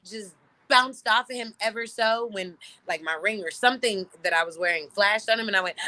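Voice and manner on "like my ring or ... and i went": ordinary talk, nothing marked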